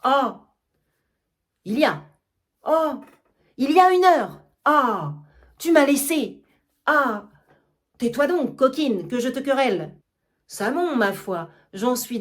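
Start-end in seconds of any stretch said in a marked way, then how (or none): 0.0-10.0 loud complaining. Acted. Toinette drowning out her master's scolding, loud wail. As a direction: wail to cut off his shouting. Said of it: "se plaint toujours en disant".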